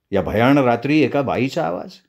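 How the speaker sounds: ordinary delivery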